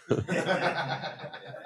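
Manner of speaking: hearty burst of laughter; laughing. Real, not acted. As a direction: laugh at the punchline. Laughs at own joke.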